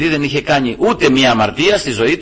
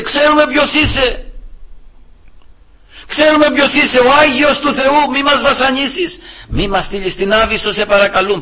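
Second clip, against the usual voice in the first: much higher, pleading demon voice, slowing on the holy name; 10 semitones higher than usual. Retold or imitated. Preacher voices demons pleading not to be sent away. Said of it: "Παρακαλούσανε τον Κύριο".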